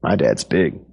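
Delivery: lightly voiced boastful kid, low pitch; low, understated schoolboy brag; bragging boy. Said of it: "heard boys say".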